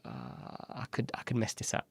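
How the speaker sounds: whispered panic to yourself; softly muttered fear of blowing it; worried whisper. Retold or imitated. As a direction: hush it as sudden dread. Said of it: "And I was like".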